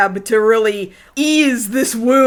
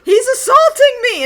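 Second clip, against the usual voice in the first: high, loud hammed-up complaint; mock outraged cry; 12 semitones higher, 5 dB louder than usual. Cowdy accusing the kid to Ryo, mock outraged cry. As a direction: cry out as if under attack. Co-host continues Cowdy's panicked cries.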